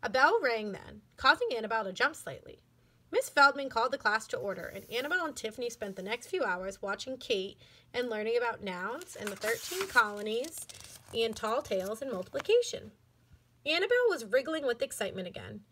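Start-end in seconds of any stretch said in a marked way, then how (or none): none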